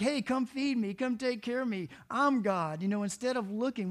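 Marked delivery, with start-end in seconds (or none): 0.0-2.8 loud, raised voice of a demanding cat; loud, entitled cat demands; self-important cat. Acted. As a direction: order them about like a cat who thinks it's God. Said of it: "we're a bunch of cats".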